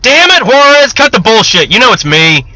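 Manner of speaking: fed up, cut him off; 1 irritated retort. Acted. Soldier snapping back at the joking sentry, annoyed.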